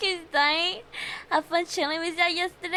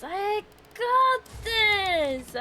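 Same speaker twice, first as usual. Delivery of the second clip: slow, higher, stretched GOT THIS; drawn-out pep talk; 4 semitones higher, much slower than usual. Real, not acted. Player steadying themself after dying, slow self-encouragement. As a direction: psych yourself up, stretching each word.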